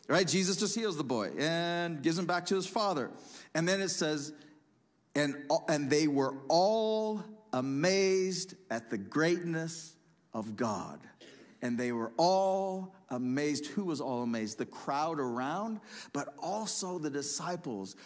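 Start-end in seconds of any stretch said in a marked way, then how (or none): 5.1-13.7 slow, stretched out, weight on all and greatness; preacher drawing out the amazement; slow, savoring delivery. Real, not acted. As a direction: linger on every word in wonder. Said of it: "May we be amazed".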